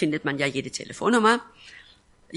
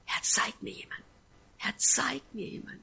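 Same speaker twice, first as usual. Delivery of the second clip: whispered prayer; 6 semitones higher, 7 dB quieter than usual. Retold or imitated. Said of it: "ich war betend vor Jesus".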